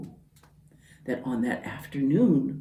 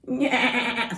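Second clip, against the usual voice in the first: goat bleat; 7 semitones higher than usual. Acted. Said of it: "said Goat".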